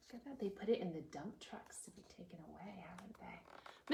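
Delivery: hushed question; whisper. Real, not acted. Reader whispering a question to the children. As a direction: lean in and whisper.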